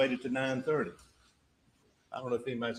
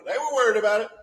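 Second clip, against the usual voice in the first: emphatic fast; 7 semitones higher, 9 dB louder, faster than usual. Acted. Closing argument, emphatic point.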